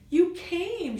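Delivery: high, loud happy exclamation; exclaimed. Acted. Shy greets Bubba at the recital, excited exclamation. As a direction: burst out with relief and joy. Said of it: "Shy exclaimed".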